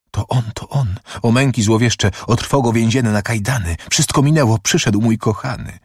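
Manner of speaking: high-pitched, rapturous relief; acted rapture, voice lifted high; joyful recognition. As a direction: exult as your lover returns. Prisoner recognises her returning lover.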